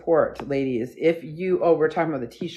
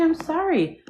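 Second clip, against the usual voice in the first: high-pitched apology; 12 semitones higher than usual. Real, not acted. Apologising to squirming dog.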